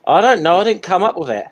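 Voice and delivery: bantering self-defence; defensive protest. Real, not acted. Defends self in teasing argument.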